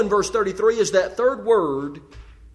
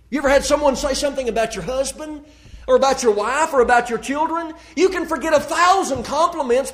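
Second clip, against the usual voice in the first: impassioned preaching; 5 semitones higher than usual. Acted. Preacher warning his church about unforgiveness, impassioned and raised. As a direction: drive it home to the congregation with rising fire. Preacher rises to full force.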